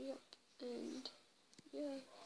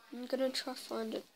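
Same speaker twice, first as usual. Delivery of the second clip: ordinary talk, nothing marked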